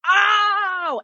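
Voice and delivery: high sudden oh of realization; surprised oh. Retold or imitated. Retelling her reaction to the show, surprised oh. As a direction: act out the lightbulb moment. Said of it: "I was like".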